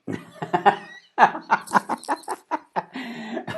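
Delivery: high, loud burst of airy laughter; laughing out loud. Real, not acted. Host cracking up at the guest's seance joke, laughing out loud.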